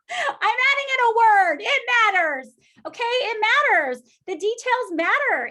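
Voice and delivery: high, loud, punching each word home; near-shouted conviction; emphatic insistence. Real, not acted. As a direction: declare it like it really matters. Insisting emphatically to her audience.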